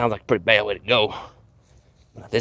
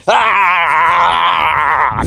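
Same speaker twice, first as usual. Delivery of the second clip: long, loud high yell; wild scream; 7 semitones higher, 10 dB louder than usual. Acted. Panicking and swinging at Predator everywhere, wild loud scream. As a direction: scream wildly while swinging a blade. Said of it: "It's like".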